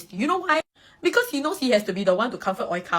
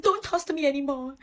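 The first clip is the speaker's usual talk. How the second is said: mock dramatic sulk; 7 semitones higher than usual. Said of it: "he'll get very dramatic".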